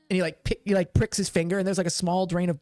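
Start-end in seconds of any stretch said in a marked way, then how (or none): none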